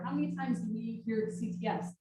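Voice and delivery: ordinary delivery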